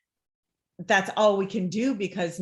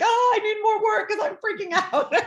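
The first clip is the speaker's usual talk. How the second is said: high laughing impression of freaking out; laughing mock panic; 13 semitones higher, 3 dB louder than usual. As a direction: squeal it out with a laugh, much higher. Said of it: "she's like".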